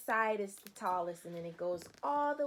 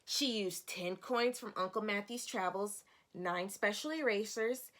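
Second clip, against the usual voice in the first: expressive picture-book narration for a class; storybook read-aloud; 5 dB louder than usual. Acted. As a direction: lively, clear reading to young children. Teacher reads picture book aloud.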